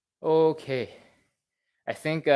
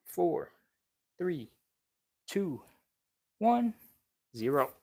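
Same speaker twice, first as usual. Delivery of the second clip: countdown; 3 semitones higher than usual. Acted. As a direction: count the seconds down calmly for the class. Coach counts down held stretch.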